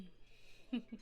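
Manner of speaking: brief, hushed knowing laugh; soft chuckle. Acted. Hostess amused by guest's question about process, soft chuckle. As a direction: give a quiet, closed little laugh.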